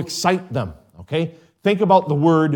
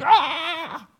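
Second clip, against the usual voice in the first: a sudden, much higher cry of exasperation; frustrated outburst cry. Retold or imitated. Frustrated parent blowing up at a child, high exasperated cry. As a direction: let it burst out like a fed-up parent. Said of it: "you're so frustrated that you're just like".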